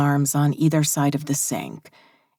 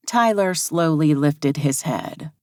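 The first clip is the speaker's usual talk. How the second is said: ordinary delivery, nothing marked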